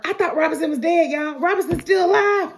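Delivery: high, loud, incredulous outburst; astonished reaction to a plot twist; shocked exclamation. Acted. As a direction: blurt it in disbelief, climbing to a yell at the end. Host reacts to plot surprise.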